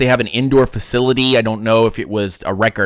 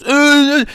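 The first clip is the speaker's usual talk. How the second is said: mock groan; 14 semitones higher than usual. Everybody goes, mocking groan.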